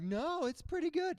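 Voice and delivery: high, prissy retort; lightly mocking coleslaw fan; mock defender. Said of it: "people right now who are like".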